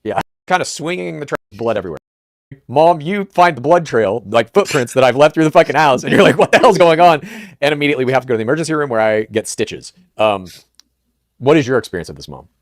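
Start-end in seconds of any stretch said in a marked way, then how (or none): 2.7-12.5 1 loud storytelling. Real, not acted. Telling Mom the bloody story.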